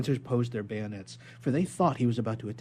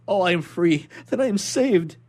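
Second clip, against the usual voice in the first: higher, louder burst of relief; joyful relief; 8 semitones higher, 11 dB louder than usual. Acted. Prisoner rejoicing before the inspector, higher and louder. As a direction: let the joy break through.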